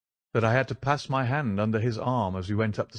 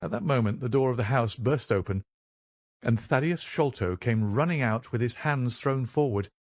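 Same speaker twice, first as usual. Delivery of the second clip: ordinary delivery, nothing marked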